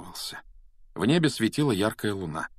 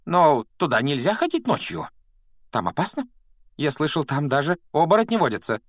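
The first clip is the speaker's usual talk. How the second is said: frightened; 8 semitones higher, 5 dB louder than usual. A narrator's reading. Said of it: "голос у него был совсем не такой самоуверенный".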